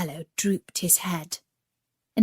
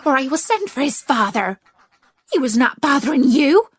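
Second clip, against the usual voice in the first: louder, higher protective scolding; fierce; 5 semitones higher, 7 dB louder than usual. Acted. Mother duck threatens to send for the father, fierce and loud. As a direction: warn them off, building in force. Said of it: "she said fiercely".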